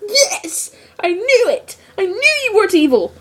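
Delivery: high, fast squeal of triumph; excited cheer. Retold or imitated. Fan cheering the twist, high and fast. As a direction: cheer like you called it. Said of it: "I was like".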